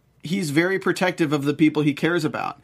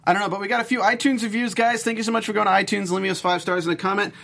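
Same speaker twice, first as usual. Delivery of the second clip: usual speaking voice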